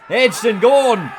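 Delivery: loud, high, drawn-out shout of the wicket; excited call. Real, not acted. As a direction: bellow it as the catch is taken. Commentator calls a wicket falling.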